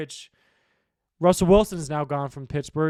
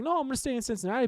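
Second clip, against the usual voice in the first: a hint of a quick, higher, breezy brush-off; mock Higgins quote; 9 semitones higher, much faster than usual. Vlogger quoting Higgins turning down the offer, quick higher mock voice. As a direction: lightly toss off the refusal, mocking.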